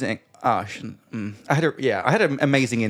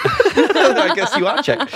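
high, loud burst through laughter; drawn-out, high, laughing delivery; 13 semitones higher, 6 dB louder, much slower than usual. Retold or imitated. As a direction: crack up while conceding the point. Retelling her own amazed reaction, laughing.

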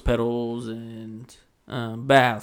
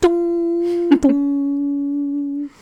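high sung ding dong chime; airplane chime; 6 dB louder than usual. Pretend. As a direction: imitate the cabin seatbelt chime.

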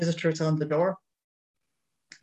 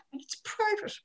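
high, squeaky mock little-girl voice, softer; mimicking a child; 9 dB quieter than usual. Housekeeper repeating the child's words to the priest, high mock child voice. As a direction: housekeeper parroting the kid's prim little voice. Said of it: "And she imitated me".

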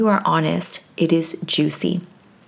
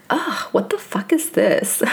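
put-on valley girl; 3 semitones higher than usual. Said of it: "I totally Valley Girl moment".

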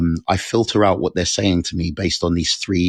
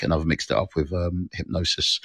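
ordinary talk

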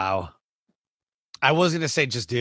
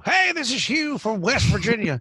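southern twang; 7 semitones higher than usual. Retold or imitated. Old West Virginia caller greeting the radio host, in a Southern twang. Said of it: "got a southern twang".

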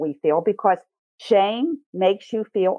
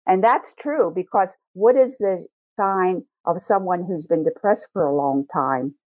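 ordinary talk

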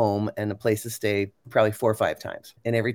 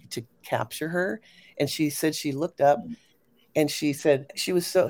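usual speaking voice

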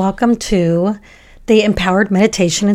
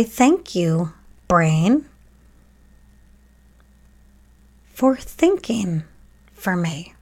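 guided meditation; 2 semitones higher than usual. Acted. Podcast host guiding children to thank heart, brain and body, guided meditation. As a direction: guide children gently through it, leaving room to breathe.

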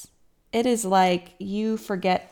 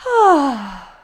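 sigh of relief; 6 semitones higher, 9 dB louder than usual. Pretend. Relief on first period day.

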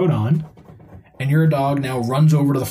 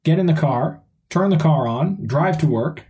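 normal talking voice

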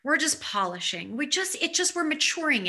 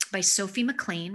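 ordinary talk, nothing marked

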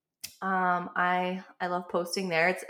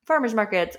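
usual speaking voice

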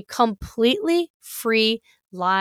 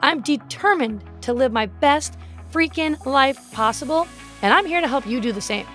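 brassy voice; 3 semitones higher than usual. Acted. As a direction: sell it like a radio host. Podcast intro read over music.

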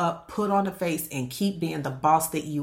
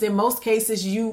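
usual speaking voice

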